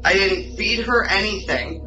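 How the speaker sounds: usual speaking voice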